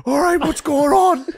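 high, loud flustered babble; flustered dad. Retold or imitated. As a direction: sputter it, confused and drunk. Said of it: "He was like".

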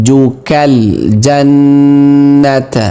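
long hummed doubled n in "জান্নাতা"; slow ghunnah on the nn in "জান্নাতা"; nasal hold. Shown as a demonstration. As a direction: hold the nn of "জান্নাতা" through the nose before releasing. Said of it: "এক আলিফ পরিমাণ গুন্না হবে".

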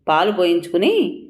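ordinary delivery

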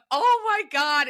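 high, loud mock dismay; overwhelmed exclamation. Recalling walking into those rooms, overwhelmed exclamation. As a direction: cry it out in dramatic dread. Retells dread walking into meetings.

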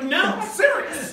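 high, slow, loud unbelieving cry; disbelieving squeal. Retold or imitated. Girl doubting the Jesus story, squealing no serious. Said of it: "I was like".

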